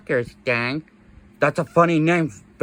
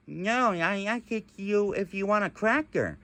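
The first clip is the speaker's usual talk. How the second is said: normal talking voice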